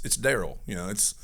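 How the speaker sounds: usual speaking voice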